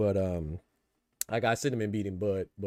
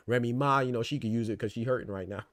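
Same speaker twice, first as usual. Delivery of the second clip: ordinary delivery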